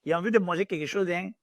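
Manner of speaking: fast, casual Ivorian French. Shown as a demonstration. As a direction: rattle it off like an Ivorian friend. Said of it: "My Ivorian French to my friend".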